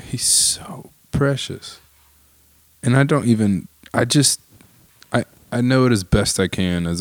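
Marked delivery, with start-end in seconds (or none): 0.0-1.8 very slow, soft whispered; tender whisper. Real, not acted. Parent overcome with love for his son, slow tender whisper. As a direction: let it fall out almost silently.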